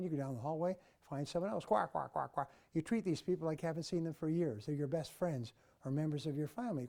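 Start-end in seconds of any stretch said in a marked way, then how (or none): 1.6-2.5 rapid run of evenly spaced quacks; duck quacking. Retold or imitated. Seminar speaker mimics office chatter as a duck farm, duck quacking. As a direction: chatter like a farm full of ducks. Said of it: "like a duck farm".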